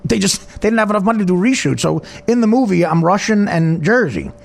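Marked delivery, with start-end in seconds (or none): none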